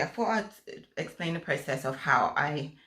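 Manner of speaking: ordinary delivery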